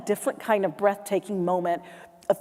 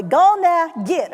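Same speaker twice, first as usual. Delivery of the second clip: louder, higher "Go on now, get" with "Go on" squeezed into "Gone"; forceful; 5 semitones higher, 4 dB louder than usual. Shown as a demonstration. Coaching the crowd, forceful "Gone now, git". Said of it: "You gotta do it forcefully".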